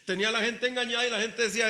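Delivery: ordinary delivery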